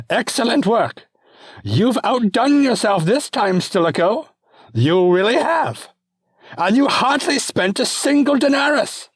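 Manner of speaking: put-on very high, loud, childish Bravo; squealing imperial delight; gleeful emperor. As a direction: cheer like a spoiled boy-king. Emperor praises Stilicho.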